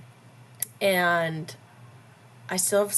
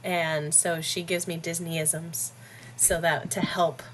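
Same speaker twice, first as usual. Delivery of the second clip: ordinary talk, nothing marked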